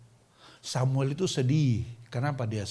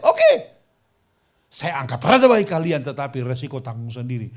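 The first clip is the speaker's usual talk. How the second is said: loud announcement; 10 dB louder than usual. Retold or imitated. Samuel gives in to people.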